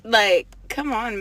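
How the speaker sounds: ordinary talk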